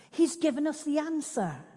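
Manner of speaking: high, loud eager call of a name; exclaiming. Acted. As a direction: burst out with the discovery. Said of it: "she exclaimed".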